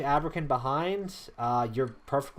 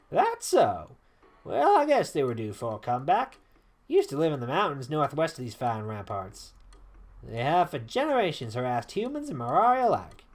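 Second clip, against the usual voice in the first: storyteller with a southern lilt; southern accent. Acted. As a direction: tell it in a put-on southern accent. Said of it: "a southern accent".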